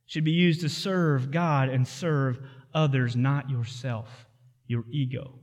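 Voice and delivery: the preacher ends his point, fading from soft to hushed. Real, not acted. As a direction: let it sink to almost nothing. Closing point fading to hush.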